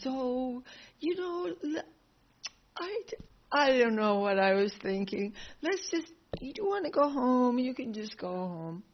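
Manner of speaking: high, eager sheepish backpedal; fawning Kamsa. Retold or imitated. Kamsa sending the parents home, high eager sheepish backpedal. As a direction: grovel your way out of it. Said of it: "And then comes".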